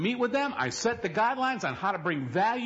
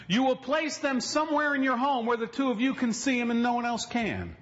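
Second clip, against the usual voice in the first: ordinary delivery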